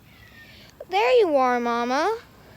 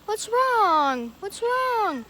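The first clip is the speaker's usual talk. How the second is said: high soothing concern; 9 semitones higher, 7 dB louder, much slower than usual. Real, not acted. Kitten crying.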